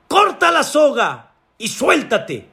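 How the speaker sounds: high, emphatic order from God; commanding. Acted. God orders climber to cut rope, commanding.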